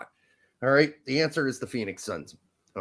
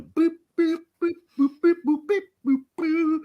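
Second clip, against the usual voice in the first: high-pitched repeated boops; playful booping; 13 semitones higher than usual. Pretend. Host clowning about a blow-up doll, playful high boops. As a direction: squeak out little boops in a high voice. Acts out silly noises.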